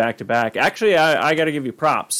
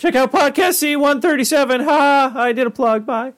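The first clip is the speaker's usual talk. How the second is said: put-on very high, squeaky boy doing a plug; high put-on adolescent voice; Morty; 13 semitones higher than usual. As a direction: chirp it in a cartoon kid voice. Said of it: "then you got a Morty".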